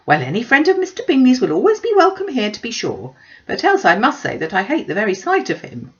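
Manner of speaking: loud, high huffy declaration; indignant mother. Acted. Mother loudly declaring to her daughters she hates Darcy. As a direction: proclaim it with offended pride.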